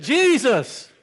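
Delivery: loud high cry of Jesus!; shouted name. Retold or imitated. Rowdy man crying out to Jesus at the altar, shouting. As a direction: call out Jesus! with everything. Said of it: "He said".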